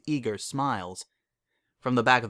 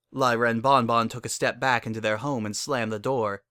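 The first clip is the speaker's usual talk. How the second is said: plain reading voice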